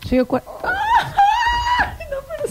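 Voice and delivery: laughing outburst. Real, not acted. Breaks up mid-word laughing.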